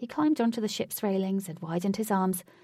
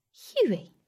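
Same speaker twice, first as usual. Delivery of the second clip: breathy disgust; 9 semitones higher, 5 dB louder than usual. Acted. Katie disgusted.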